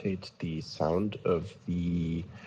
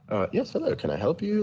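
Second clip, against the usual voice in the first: higher-pitched, polite phone-operator voice; calm customer support; 10 semitones higher than usual. Acted. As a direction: answer like a composed help desk. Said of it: "used the completely normal voice, but very calm".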